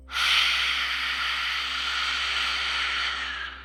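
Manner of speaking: long, airy exhale through the mouth; deep sigh. Shown as a demonstration. Guide demonstrates the exhale for meditators, long deep sigh. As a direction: let a slow breath stream out in a sigh. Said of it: "exhale for five seconds out of your mouth".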